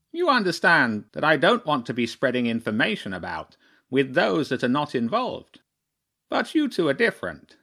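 put-on measured, self-important bird taking children into confidence; crow chairman, confiding.

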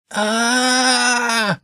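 long high held scream; falling rat's scream. A narrator's reading. Axe rat screams as it falls toward the tent, long high scream. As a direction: wail as you drop through the air. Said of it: "it screamed".

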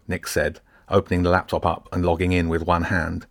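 usual speaking voice

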